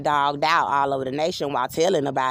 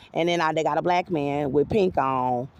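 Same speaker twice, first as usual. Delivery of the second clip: ordinary talk, nothing marked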